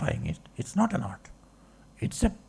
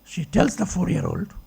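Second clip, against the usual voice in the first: ordinary talk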